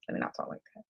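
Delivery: barely voiced, under the breath; whispered self-correction. Real, not acted. Speaker correcting her own wording, whispered. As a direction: catch yourself and whisper it to yourself. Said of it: "no, let me not talk like that".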